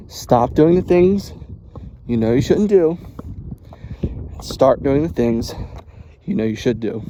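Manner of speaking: slow, punchy stressed advice; emphatic life advice. Shown as a demonstration. Vlogger laying down his rule to viewers, slow and punchy. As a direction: hit each word like a rule.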